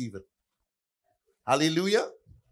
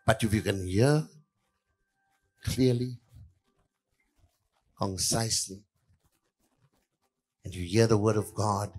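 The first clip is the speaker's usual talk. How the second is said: near-whispered sermon lines; hushed preaching; 9 semitones lower, 9 dB quieter than usual. Acted. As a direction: drop the voice low and soft, drawing them in.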